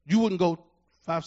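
ordinary talk

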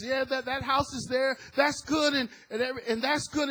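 high, loud retold relief; hopeful exclaiming. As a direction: lift it up, half shouting with hope. Husband relieved on road.

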